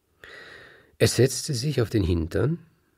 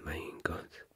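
whisper; 3 semitones lower, 13 dB quieter than usual. A narrator's reading. Siegen reflects alone.

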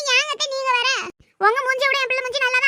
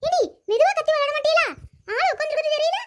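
put-on higher, cranky old granny complaining; grumpy grandmother; 5 semitones higher than usual. Grandma scolding noisy kids playing near her, cranky.

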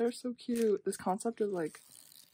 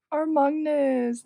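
loud, high burst of delight; gasp, then exclaim it loudly; excited fan cry; 5 semitones higher, 12 dB louder than usual. Real, not acted. Fan spotting the youngest member, excited cry.

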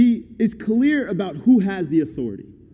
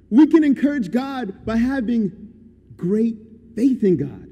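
normal talking voice

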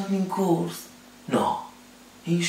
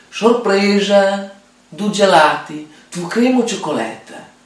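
surprise announcement; 6 semitones higher, 8 dB louder than usual. Retold or imitated. Retold wife announcing surprise ice creams.

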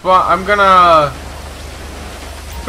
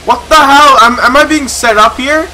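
higher, louder, startled outburst; alarmed disbelief; 6 semitones higher, 6 dB louder than usual. Real, not acted. Officer realising the call is a setup, alarmed disbelief. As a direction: cry out as if caught in a trap. Caught in a game ambush.

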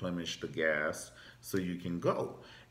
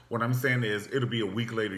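ordinary delivery

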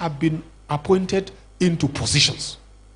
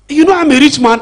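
a hint of the rich man's brag, higher and louder; lightly voiced boastful rich man; boasting rich man; 8 semitones higher, 7 dB louder than usual. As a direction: play the proud rich man lightly, lifting the voice.

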